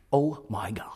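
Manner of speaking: a whispered gasp of awe; awed whisper. Real, not acted. Preacher whispering in awe at the giants. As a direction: breathe it out in a hushed whisper.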